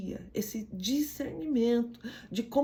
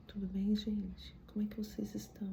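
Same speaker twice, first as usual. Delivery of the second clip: timid whisper; 11 dB quieter than usual. Retold or imitated. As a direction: greet the party so quietly it barely carries. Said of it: "As pessoas vão olhar pra você".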